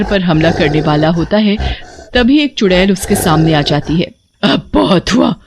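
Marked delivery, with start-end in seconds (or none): 4.4-5.3 witch voice. Acted. Witch confronts the wolf.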